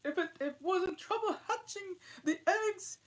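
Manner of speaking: high, loud, tearful bird voice; complaining pigeon. Acted. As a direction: whine like a weary pigeon. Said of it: "said the pigeon".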